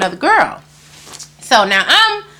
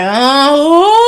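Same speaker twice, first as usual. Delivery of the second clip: drawn-out mock clueless question; imitating playing dumb; 3 semitones higher, 4 dB louder than usual. Imitating the man behind the locked bathroom door, playing dumb. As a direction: play innocent in a mock dopey voice. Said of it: "acting stupid".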